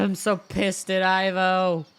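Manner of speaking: slow, loud and deliberate anger; genuinely mad. Real, not acted. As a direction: drag out so with seething weight. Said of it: "I'm genuinely mad".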